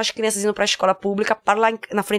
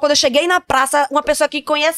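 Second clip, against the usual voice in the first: upset father; 5 semitones higher, 3 dB louder than usual. Retold or imitated.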